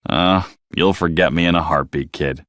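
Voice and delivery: a hint of a gruff old-timer teasing; gruff octopus saying goodbye to the kid. A narrator's reading. Hank voiced gruffly.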